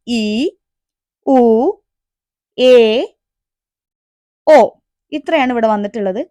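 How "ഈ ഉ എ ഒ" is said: each vowel said alone and clear, with a pause between; teacher drilling Japanese vowels; reading vowels aloud. Shown as a demonstration. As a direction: read the vowels one by one for the class. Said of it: "ആ എന്നാണ് ഇപ്പൊ എഴുതിയത്".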